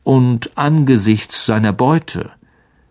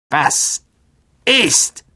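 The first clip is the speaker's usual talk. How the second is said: sharp high question from a blind spider; a woken monster demanding answers; old spider asking; 6 semitones higher than usual. A narrator's reading. Aragog wakes and asks, high clicking creature voice. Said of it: "klickte schnell mit ihren Greifern".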